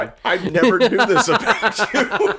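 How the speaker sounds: high, laughing disbelief. Real, not acted. Amused at friend's hatred of Dunkin', laughing. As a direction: giggle through the surprise.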